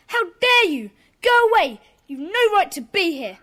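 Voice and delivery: loud, indignant shout at an intruder; furious, raise the voice to order her out; angry outburst. Acted. Boy ordering Susie out of the meeting, furious shout.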